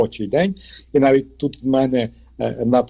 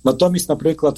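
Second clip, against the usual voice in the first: normal talking voice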